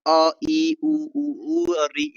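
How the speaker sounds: अ slow then ई, उ pitched high; vowel demo. Shown as a demonstration. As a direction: call out the vowels class की तरह. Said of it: "खोल के". (स्वर खोलकर बोलना)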